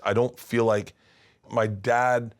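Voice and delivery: ordinary talk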